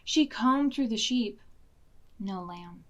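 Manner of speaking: ordinary delivery, nothing marked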